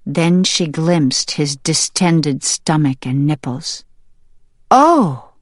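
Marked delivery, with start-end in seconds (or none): 4.7-5.3 high, loud gasp of realization; stunned. A narrator's reading. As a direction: cry out in sudden shock. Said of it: "Sprout was stunned".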